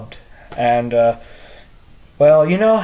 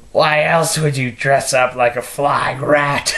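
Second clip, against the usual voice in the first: Mark Hamill Joker. Acted. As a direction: savour each word like a gloating supervillain. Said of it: "I did it in my Mark Hamill impression".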